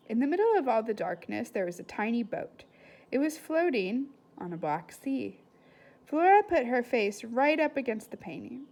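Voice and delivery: put-on lively read-aloud to children; story reading. A narrator's reading. Teacher reading a novel aloud to her class, lively. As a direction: bring the chapter to life for the class.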